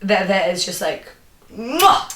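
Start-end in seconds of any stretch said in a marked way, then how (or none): 1.6-2.1 a higher, playful smooching mwah; chef's kiss. Acted. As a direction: blow a chef's kiss with relish. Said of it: "Chef's kiss".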